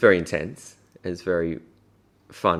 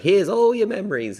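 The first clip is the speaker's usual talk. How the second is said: high, sing-song mum voice; mother offering the box, high and bright; Mum's voice; 4 dB louder than usual. Retold or imitated. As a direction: hand it over like a proud mum. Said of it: "she's like".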